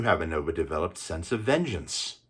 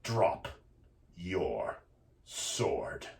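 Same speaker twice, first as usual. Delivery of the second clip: usual speaking voice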